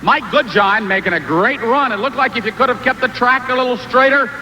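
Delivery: ordinary talk, nothing marked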